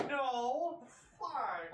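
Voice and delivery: loud, high cry of dismay; frustrated shout. Real, not acted. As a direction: yell out at the failure. Player reacts to failed attempt.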